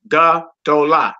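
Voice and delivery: Ga-dola said slowly as three beats, ga-do-la; three-syllable demo. Shown as a demonstration. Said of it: "which is three syllables".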